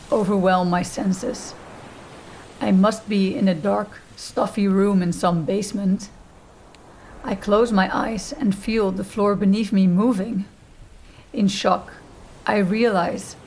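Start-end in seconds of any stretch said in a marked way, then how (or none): none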